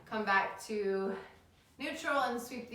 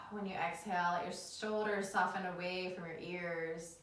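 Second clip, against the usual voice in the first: calm them down into the breath; soothing yoga teacher; 3 dB quieter than usual. Acted.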